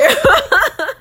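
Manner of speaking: high, loud burst of laughter. Real, not acted. Laughs at own remark.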